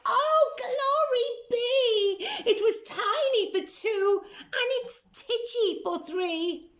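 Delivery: put-on high, laughing-crying old lady wail; old lady crying out over the crowding. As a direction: wail in mock despair, high. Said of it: "The little old lady cried".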